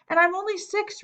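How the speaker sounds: high whiny reminder. A narrator's reading. Little boy whines to the girl about his age, high and pleading. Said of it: "Daniel whined".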